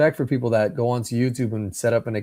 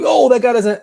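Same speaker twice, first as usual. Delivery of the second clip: shocked; 13 semitones higher, 9 dB louder than usual. Retold or imitated. Shocked seeing driverless Tesla.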